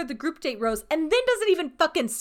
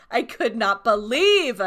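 normal talking voice

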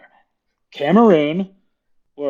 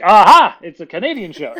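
triumphant aha; 5 semitones higher, 10 dB louder than usual. Retold or imitated. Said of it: "And I'm like".

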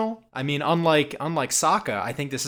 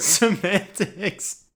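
amused drawn-out mockery; 5 semitones higher, much slower than usual. Real, not acted. Mocking co-host's correction.

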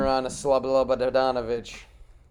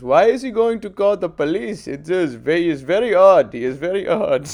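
high, louder put-on Indian accent; imitating Indian accent; 4 semitones higher, 7 dB louder than usual. Clerk puzzling over the threat, put-on Indian accent. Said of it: "The Indian guy goes".

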